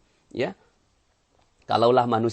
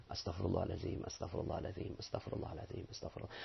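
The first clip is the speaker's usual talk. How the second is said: very soft, low murmured prayer; hushed, repeated penitent whisper; contrite istighfar; 6 semitones lower, 15 dB quieter, faster than usual. Shown as a demonstration. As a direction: murmur it privately, fading away. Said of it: "Maka pengucapan istighfar menjadi benar".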